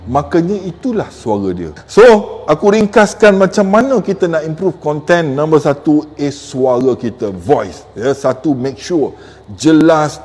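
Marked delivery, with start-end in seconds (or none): none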